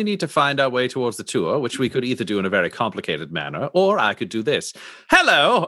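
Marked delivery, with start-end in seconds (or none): 5.1-5.6 a high, loud cheery hello; bright greeting. Acted. Player hailing a stranger on the street, bright and loud. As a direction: call out a chirpy hello.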